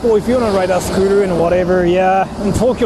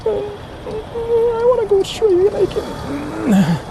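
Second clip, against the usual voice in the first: drawn-out whimpering complaint, much higher and very slow; long whiny moan of frustration; slow high whine; much slower than usual. Real, not acted. Stuck, whining to go straight.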